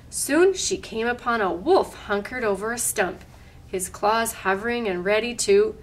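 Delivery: usual speaking voice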